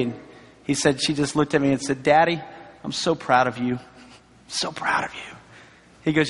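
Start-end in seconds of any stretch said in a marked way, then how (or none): 2.0-5.4 low, hushed, moved delivery; tender retold pride. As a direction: soften and lower it, fading almost to a whisper. Retelling daughter's tender words to father.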